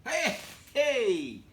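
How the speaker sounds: sudden high, loud call of hey; a sharp startled hey, hey. Real, not acted. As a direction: shout it out, startled. Calls out as wife sneaks up.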